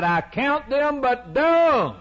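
high, slow, ringing declaration; impassioned cry. Acted. Preacher voicing Paul renouncing everything for Christ. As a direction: cry it out with full conviction. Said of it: "he cried".

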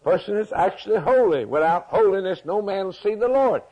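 high-pitched, driving pulpit delivery; impassioned preaching. Real, not acted. Preacher declaring holiness to the congregation, impassioned and high.

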